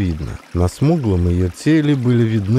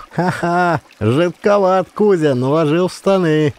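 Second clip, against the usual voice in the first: 1 mocking laughter; 8 semitones higher than usual. A narrator's reading. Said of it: "смеялись пацаны".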